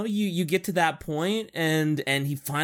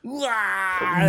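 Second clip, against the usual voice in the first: a mock orc battle cry, shouted "Wah!"; orc war cry. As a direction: bellow "Wah!" like a charging orc. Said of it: "don't, don't say wog".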